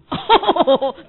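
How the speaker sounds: short burst of laughter. Real, not acted. Tells the meeting about her marriage, bursting into laughter. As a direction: laugh out loud at the memory. Laughs after love line.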